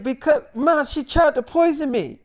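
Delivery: indignant protest. Retold or imitated. Daughter insists to her mom that she was attacked, indignant high voice.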